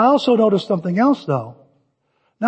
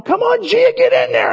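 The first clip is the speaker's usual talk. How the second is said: cheering call. Retold or imitated. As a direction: urge her into the play. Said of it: "I'm going".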